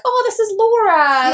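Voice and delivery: high, slow, sing-song "oh this is Laura"; fake breezy introduction. Covering a forgotten name, a high slow sing-song introduction. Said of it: "so I'm like".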